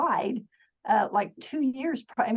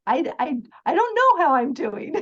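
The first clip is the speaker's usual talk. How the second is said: exasperated venting; 5 semitones higher, 5 dB louder than usual. Retold or imitated. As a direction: vent like life has fallen apart, half laughing. Sister venting about her life.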